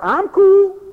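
high, loud imitation, held on the last word; mock cool person. Preacher imitating what they say down here, high and loud. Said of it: "or else they say".

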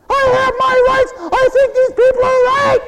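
high, loud mocking protest; mock indignant wife. Preacher imitates a wife mad at hubby, high and loud. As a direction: squeal it like an offended wife.

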